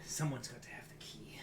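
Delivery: hushed, whispered mutter; low, barely audible grumble to yourself. Acted. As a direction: mutter it under your breath as you search. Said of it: "you can hear him muttering".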